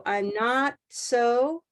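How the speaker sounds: slow, linked syllables; connected syllables. Shown as a demonstration. Teacher modelling for student, slow linked syllables. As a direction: glide each syllable into the next. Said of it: "more connection between each syllable".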